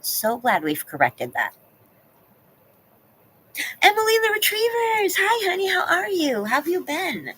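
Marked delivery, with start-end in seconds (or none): 3.8-7.3 high, sing-song hello to a dog; excited high greeting. Real, not acted. Greeting a viewer's dog, high excited voice. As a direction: gush a hello up high like to a pet.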